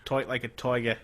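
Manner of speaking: tight like a toiger, Boston vowels; Boston accent. Pretend. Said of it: "Boston accent?".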